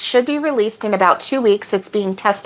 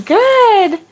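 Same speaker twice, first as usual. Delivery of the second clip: excited; 11 semitones higher, 4 dB louder than usual. Real, not acted. Cheering on viewer's progress.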